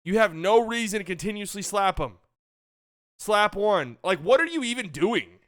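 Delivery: angry fan venting; exasperated anger. Real, not acted. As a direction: keep venting at the slapping. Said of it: "had me actually angry".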